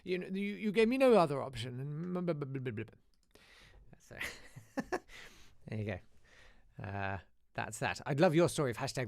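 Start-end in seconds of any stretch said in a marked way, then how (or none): 0.0-2.8 apologetic teacher. Retold or imitated. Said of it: "And he was like".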